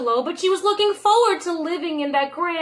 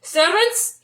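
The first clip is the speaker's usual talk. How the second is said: loud call to the servants; 5 semitones higher, 4 dB louder than usual. A narrator's reading. Angelo calling out to his servants. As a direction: summon them across the house. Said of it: "Angelo called".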